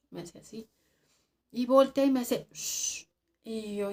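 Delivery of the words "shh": hushing shh. Retold or imitated. Omar hushing her again.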